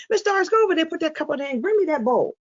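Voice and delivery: high-pitched run of orders without waiting; wrong-way demo. Shown as a demonstration. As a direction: demonstrate rattling off instructions right after the name. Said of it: "Don't say".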